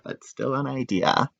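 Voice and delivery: usual speaking voice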